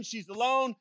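ordinary delivery

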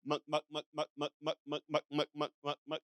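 soft, low repeated clucking call; demonstrating a shepherd's sheep call. Shown as a demonstration. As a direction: murmur it like a shepherd calling his flock. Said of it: "it sounds like this".

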